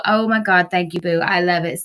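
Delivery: usual speaking voice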